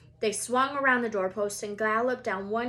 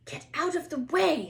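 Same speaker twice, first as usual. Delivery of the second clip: higher, louder snap; snapped; 7 semitones higher, 5 dB louder than usual. A narrator's reading. Ron snapping at Peeves, angry and higher. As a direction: snap at him. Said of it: "snapped Ron".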